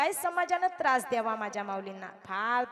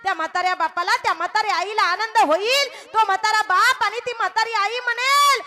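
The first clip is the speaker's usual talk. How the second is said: climbing higher and louder line by line; rising kirtan preaching; 9 semitones higher, 10 dB louder than usual. Acted. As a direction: build the sermon to a peak. Preaching builds to a peak. (चढत्या आवाजात कीर्तन)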